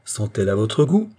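usual speaking voice

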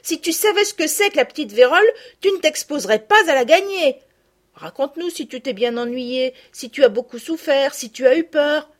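lightly voiced curious girl; eager girl. A narrator's reading. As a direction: press her with questions. Girls' dialogue.

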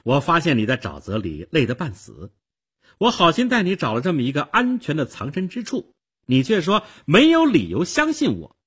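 put-on high, loud, aggrieved girl; indignant outcry. A narrator's reading. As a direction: build from reproach to loud indignation. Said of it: "少女气愤地叫了起来". (气愤地叫喊)

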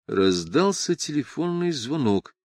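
ordinary delivery, nothing marked

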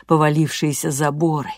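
ordinary delivery

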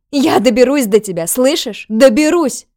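loud tearful threat; angry crying. Acted. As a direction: spit the threat through tears. Captive threatens through tears.

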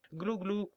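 high mocking gobble gobble; turkey gobble. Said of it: "dit le dindon".

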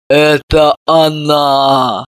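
slow, low, heavy tragic cry; tragic exclamation. A narrator's reading. Mother coming round, declaring the culprit tragically and slowly. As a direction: drag it out like a stage tragedy. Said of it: "трагически воскликнула".

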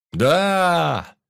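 loud, high crew cheer; long jubilant shout; joyful cheer. A narrator's reading. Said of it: "обрадовалась команда".

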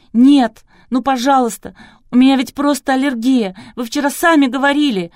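loud, high, desperate begging; wailing plea. A narrator's reading. Kristina begs the doctor it is allergy, wailing. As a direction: plead as if on your knees. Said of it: "снова завопила Кристина".

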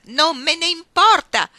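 very loud, high, slow defiance; defiant yell. Acted. Iggy to Ruth scolding her behaviour, defiant yell. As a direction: hurl each word back at her.

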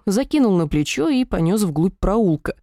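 ordinary delivery

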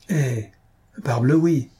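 a mild mocking jab. A narrator's reading. Jacques mocks the idea of excluding the de Praille, mild mockery. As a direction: tease them lightly. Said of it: "dit moqueusement Jacques de Bièvre".

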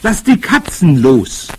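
loud, high, drawn-out battle cry; calling out. A narrator's reading. Boy urges grandmother to release cats, calling out. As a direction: proclaim it slowly and boldly. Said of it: "rief ich".

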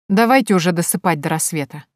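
plain reading voice

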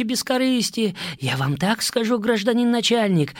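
high, feverish rambling prisoner; rambling addict. Acted. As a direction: confess in a frantic babble.

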